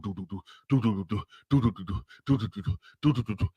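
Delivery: low rapid do-do heartbeat; heartbeat imitation. Jen hiding with racing heart, low heartbeat sounds. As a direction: tap out a racing pulse with your voice.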